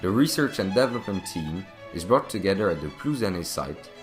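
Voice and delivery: ordinary talk, nothing marked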